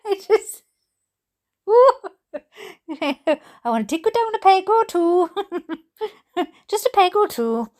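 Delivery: giggling through the words; talking through real laughter; laughing. Real, not acted. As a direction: laugh while you say it. Laughing at the letter writer.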